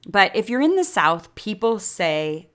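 ordinary talk, nothing marked

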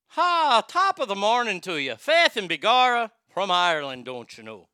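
hammed-up, loud Irish greeting; Irish accent. Host joking about immigrant ancestors, hammed-up Irish accent. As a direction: lilt it like a stage Irishman. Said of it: "the Irish accent's not bad".

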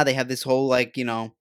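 ordinary talk

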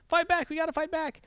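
rally the crowd in a high, urgent voice; mock rallying cry. Lois rallying slaves against the worm, high urgent call.

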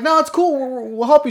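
high eager offer to help; high, overly helpful teen voice; eager boys. Retold or imitated. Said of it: "And we're like".

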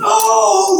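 a sudden high shout of alarm; a retold shriek, high and loud; mock scream. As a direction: cry out like a parent hearing the kids scream. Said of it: "they're screaming".

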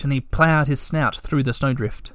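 usual speaking voice

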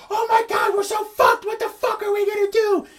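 very high mock shriek of panic; panicked woman. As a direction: wail it in a hammed-up falsetto. Acting out panicking woman from the car.

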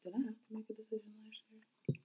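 whispering to herself; a quiet whispered aside. Real, not acted.

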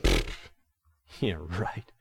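soft dismissive scoff. Retold or imitated. Jonah scoffing at God's command, soft dismissive pfft. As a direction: brush God off with a sneer. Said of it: "Jonah said".

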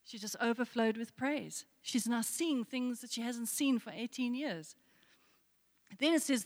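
ordinary talk, nothing marked

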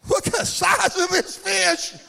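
high, slow marvelling holler; hammed-up awe over a whopper; showman's amazement. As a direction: marvel at the catch, stretched out and high. Showing off a big fish.

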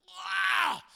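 high, loud roar of rage; imitated angry teen's yell; mock rage yell. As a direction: let out a hammed-up furious holler. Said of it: "I would go into this rage".

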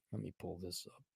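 softly mumbled to himself; quiet mutter. Real, not acted. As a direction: mutter it under his breath. Muttering while searching phone.

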